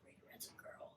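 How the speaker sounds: put-on hushed kid whisper; whispered secret. Girls telling each other the mascot secret, whispered.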